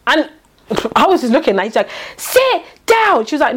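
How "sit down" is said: imitated shouting at a much higher pitch; mock the officer's yelled command; retold shout. Officer ordering a woman in her face, mock shouting. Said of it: "Shouting in her face".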